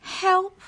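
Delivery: very high, loud squealed cry for help. A narrator's reading. Boy falling from the climbing wall, high squeal. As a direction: squeal it in panic as you fall. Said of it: "he squealed".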